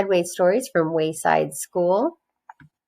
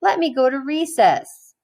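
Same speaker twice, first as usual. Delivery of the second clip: put-on higher, quicker kid voice pleading; a little boy protesting to his teacher; whiny child Joe; 7 semitones higher than usual. A narrator's reading. Teacher reading to class, voicing a pleading boy. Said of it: "said Joe".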